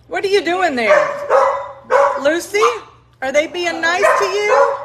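high cooing pet voice. Real, not acted. Woman asking puppy Lucy if others are nice, high baby talk. Talking to puppies on camera.

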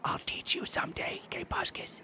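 soft unvoiced whisper. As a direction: whisper it under your breath. Whispered aside.

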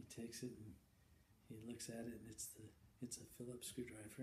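soft, hushed storytelling. A narrator's reading. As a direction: draw them in quietly. Hushed telling of the miracle.

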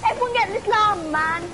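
high, loud, thrilled yelling; excited shouting. Real, not acted. Gamer yelling to teammates about a llama.

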